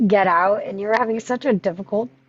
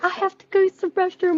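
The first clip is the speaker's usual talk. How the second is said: pitched way up, imitating herself; high mock voice; 10 semitones higher than usual. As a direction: toss it off in a high put-on voice. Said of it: "I was like".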